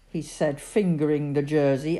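plain reading voice

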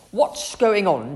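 loud, slow, cross demand. A narrator's reading. Mum confronts the huntsmen, slow loud cross demand. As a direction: confront them sternly. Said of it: "she demanded".

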